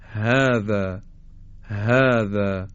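"هذا" slowly, with an open, airy h; unconstricted h. Shown as a demonstration. Teacher models هذا, h without throat constriction. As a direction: let air pass freely at the start of "هذا". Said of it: "این گرفتگی در حلق ایجاد نمیشه".

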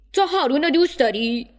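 father questioning his daughter about the suitor; uncle asking. Acted. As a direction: ask the daughter knowing her answer.

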